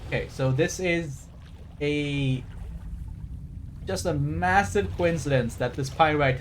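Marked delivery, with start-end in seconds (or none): none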